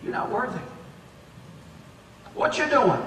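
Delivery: low, soft, sly accusing voice; the devil whispering. Acted. The devil whispering accusations to a believer, soft and sly. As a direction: hiss doubts into their ear like the devil. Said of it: "This is the devil talking".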